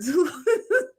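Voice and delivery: giggling up high; short burst of laughter. Real, not acted. Vlogger giggles at the comment, high laugh.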